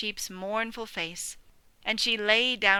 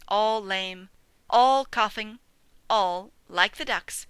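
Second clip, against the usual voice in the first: usual speaking voice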